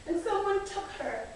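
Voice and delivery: plain reading voice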